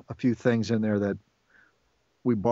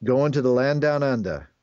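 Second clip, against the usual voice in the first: louder, put-on Australian accent; Aussie accent; 4 dB louder than usual. Shown as a demonstration. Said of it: "an Aussie accent".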